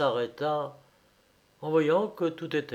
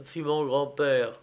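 usual speaking voice

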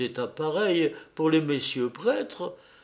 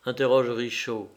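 ordinary delivery, nothing marked

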